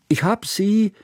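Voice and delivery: high call from another room; calling out from the kitchen. Acted. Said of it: "rief Hannaka noch aus der Küche".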